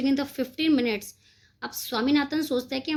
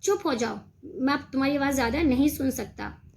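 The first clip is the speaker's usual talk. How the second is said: ordinary talk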